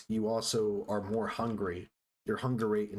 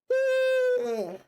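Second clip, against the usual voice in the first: big high loud yawn; long squeaky yawn; 7 dB louder than usual. Real, not acted. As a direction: let out a huge yawn. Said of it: "Sorry, guys, I'm yawning".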